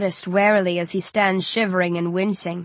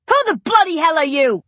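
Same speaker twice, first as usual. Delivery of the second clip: high, loud furious roar; 9 semitones higher, 5 dB louder than usual. Acted. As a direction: roar it at the stranger. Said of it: "I roar".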